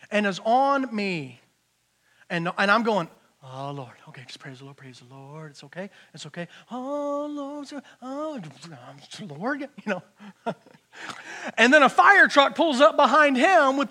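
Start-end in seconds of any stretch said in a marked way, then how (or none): 3.4-9.6 nervous prayer. Retold or imitated. Nervous prayer with car on tail.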